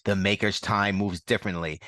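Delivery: ordinary delivery, nothing marked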